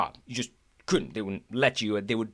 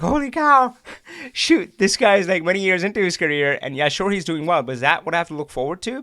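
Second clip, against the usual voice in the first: louder and higher, stunned disbelief; retelling his startled inner reaction; shocked; 5 semitones higher, 6 dB louder than usual. Retold or imitated. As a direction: blurt it out in alarmed surprise. Reacting to colleague's divorce confession.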